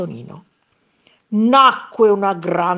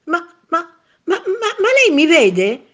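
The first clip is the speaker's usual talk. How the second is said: anxious stammer; 10 semitones higher, 5 dB louder than usual. A narrator's reading. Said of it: "domandò Tonino pieno d'ansia".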